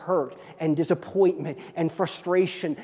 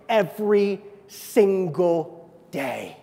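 slow refrain; much slower than usual. Acted.

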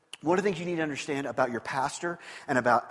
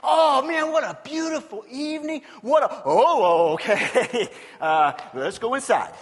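high, loud casual mock David; hammed-up David, higher and louder; David glancing away; 6 semitones higher, 8 dB louder than usual. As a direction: brush it off and head inside. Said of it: "if David had done this".

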